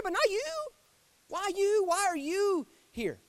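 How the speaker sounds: high, incredulous voice of a let-down God; a God stunned to find his prophet hiding; disappointed God. Acted. God asking a prophet hiding in a cave, hurt and surprised. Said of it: "I wonder if he said".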